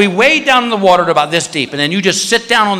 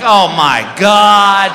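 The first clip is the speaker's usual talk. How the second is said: very slow, drawn-out awe with a laugh; mock stunned gasp; 5 dB louder, much slower than usual. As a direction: stretch it out in shock. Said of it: "I'm like".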